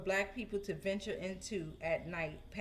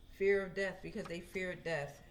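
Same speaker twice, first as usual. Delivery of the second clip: ordinary talk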